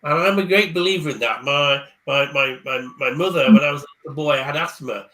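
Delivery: ordinary talk, nothing marked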